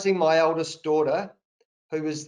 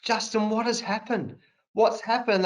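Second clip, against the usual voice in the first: upset fans; 5 semitones higher than usual. Retold or imitated. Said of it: "thirty people come up to me".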